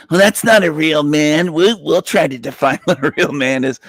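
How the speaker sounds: lightly voiced, sneering feminist with a laugh; a hint of a scornful feminist, mocked; mocking feminist. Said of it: "feminists would say".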